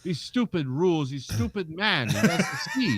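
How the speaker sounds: hammed-up angry foreign trucker; mad foreigner. Refused trucker rants about stupid rules, mock anger. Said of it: "this guy was mad".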